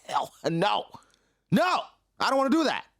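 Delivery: high, loud, quick mock refusal; a kid flatly turning the offer down; hammed-up bratty no, fast and high; refusing outright. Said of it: "Loki said".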